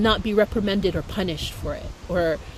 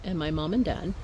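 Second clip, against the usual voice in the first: ordinary delivery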